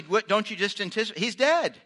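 normal talking voice

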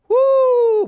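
sudden loud high "woo"; loud high whoop. Acted. Pastor exclaiming at anger building overnight, loud whoop. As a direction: let out a big whooping cry.

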